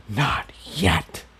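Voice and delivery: voiceless, hushed hiss from inside the head; hissed whisper. A narrator's reading. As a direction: hiss it like a secret voice in his mind. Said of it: "hissed the voice".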